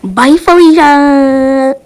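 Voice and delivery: slow, loud, drawn-out "Bye, Felicia"; loud mocking send-off; sassy dismissal. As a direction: dismiss them with big sass. Dismissive farewell.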